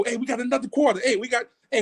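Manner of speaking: usual speaking voice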